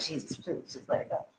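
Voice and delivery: hushed, breath-held begging; barely voiced desperate plea; whispered plea. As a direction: pray it under your breath. Silent plea during endless meeting.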